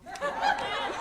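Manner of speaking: high-pitched burst of laughter; nervous laughter. Real, not acted. Student who hates their prepared speech, laughing helplessly. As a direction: break into real laughter mid-speech.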